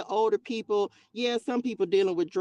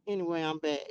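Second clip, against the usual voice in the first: ordinary talk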